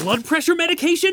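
high, rising alarmed question; alarmed surprise. Acted. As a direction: jolt up in alarm at the words. Alarmed at forgotten medication.

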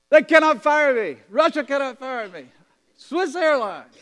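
loud, high, jubilant rush of good news; excited news. Retold or imitated. Alexei tells the preacher he got a job, loud and jubilant. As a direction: burst out with joy.

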